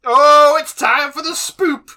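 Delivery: loud, high, drawn-out proclamation; hammed-up spooky announcement. Podcaster hailing Halloween season to co-hosts, hammed-up loud announcement. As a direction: herald the spooky season at full volume.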